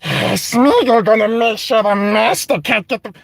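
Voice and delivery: slow, scheming creature voice in the throat; sly muttering Gollum impression, slowed down. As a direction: creep through it like a plotting Gollum. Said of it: "he's already like".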